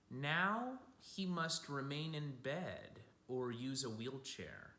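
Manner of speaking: gentle picture-book storytelling; storybook voice for kids; reading to children. A narrator's reading. Reads picture book aloud.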